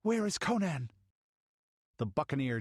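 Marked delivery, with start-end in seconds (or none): 0.0-0.9 louder, higher demand; urgent demand. Acted. Balessa confronts Zerono, urgent. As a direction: press him for an answer.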